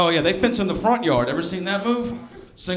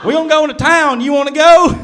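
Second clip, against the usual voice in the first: hollering country guy; 10 semitones higher, 9 dB louder than usual. Acted. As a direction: call out like a hammed-up country dude. Acting country guys calling out.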